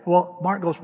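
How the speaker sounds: ordinary talk, nothing marked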